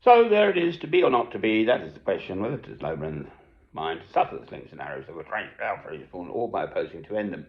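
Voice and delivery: loud theatrical Hamlet recital; dramatic declamation. Acted. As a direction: declaim it like on stage. Declaims Hamlet soliloquy again.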